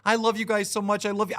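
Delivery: imitating a pandering streamer's over-sweet love; sarcastic put-on of a suck-up host; mock pandering streamer. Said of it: "pandering fucking idiots".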